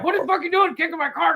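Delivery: high, fast indignant outburst; mock outraged yell. Driver yelling at the woman who kicked his car, mock outrage.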